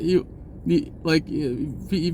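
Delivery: normal talking voice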